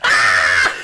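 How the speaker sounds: loud mock scream of a crushed kid; imitated scream. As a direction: scream like the kid under the snowball. Imitating screaming in snowball story.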